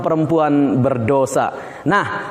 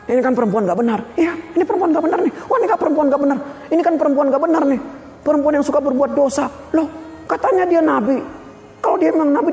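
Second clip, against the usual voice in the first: higher, quick sneering chatter; mock the woman behind her back; scornful gossip; 6 semitones higher than usual. Pharisees gossip about the woman. (orang Farisi bergunjing dengan nada mencibir)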